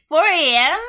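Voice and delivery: loud, high disbelief; incredulous outburst. Real, not acted. Reacting to a four A M work start, incredulous outburst. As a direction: blurt it out as a shocked question.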